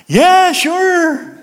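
high, loud mock holler; whooped drunken yes. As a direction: belt it out like an eager drunk. Drunk man's answer to altar call.

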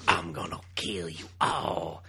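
slow, low put-on evil elf; silly villain voice. Mocking Nightcrawler's dialogue in a slow low villain voice. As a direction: drawl it like a cartoon villain. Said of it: "is that how he sounds?".

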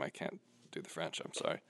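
whispered embarrassed apology; a real whispered aside after fumbling a French name; sheepish whisper. Real, not acted. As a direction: drop to a whisper, abashed.

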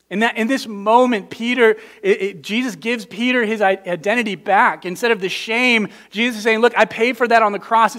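rising passionate preacher; impassioned preaching. Acted. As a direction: preach it with urgency. Preaching at full force.

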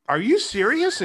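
high, loud retold disbelief; stunned disbelief. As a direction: replay the moment you couldn't believe your ears. Offered five million on the spot.

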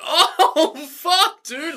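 high, laughing exclamation; mock shocked delight. Girlfriend reacting to her boyfriend being called out, high mock shock. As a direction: squeal it in hammed-up shock, half laughing.

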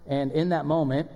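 ordinary talk

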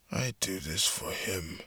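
slow, cold murmur; quiet. Acted. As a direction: say it softly and slowly. About to execute enemy.

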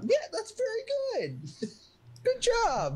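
put-on high, slow baby-talk praise. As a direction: praise a toddler sweetly.

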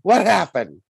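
high, loud panicked yelling; frantic daughter yelling at her mother; frantic screaming. Pretend. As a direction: shriek it out in a full panic. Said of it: "I would be screaming".

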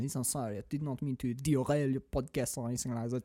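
put-on meek, very soft French accent; put-on French accent, backing down. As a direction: apologize low and quiet as a chastened Frenchman. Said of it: "French man".

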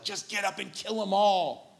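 high, pleading cry to fight back; urging outburst. Retold or imitated. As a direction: burst out like a frustrated viewer. Viewer urging Aslan to fight.

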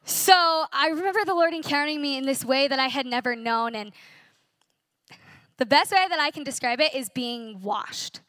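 normal talking voice

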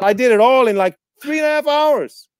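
high, loud, incredulous climax; retold amazement. Co-star amazed at the quick shoot, high and incredulous. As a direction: build to a high, disbelieving finish. Retells co-star's amazed complaint.

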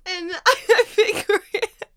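high, slow tearful words broken by loud laughter; real sobby laughing through the words; laugh-crying. Real, not acted. Podcaster explaining her cramps to co-host, laugh-crying. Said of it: "These are actually real tears".

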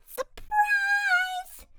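very high, loud squeaky reveal; a girl springing her surprise; high girly "Surprise!"; girl's surprise. Acted. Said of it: "opened the box and said".

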